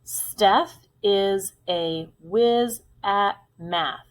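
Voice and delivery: slow, separated words; teacher's slow sentence dictation. Shown as a demonstration. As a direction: say each word on its own for young writers. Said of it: "Let's say our sentence one more time".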